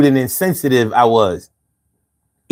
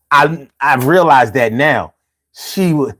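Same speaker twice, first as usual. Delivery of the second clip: usual speaking voice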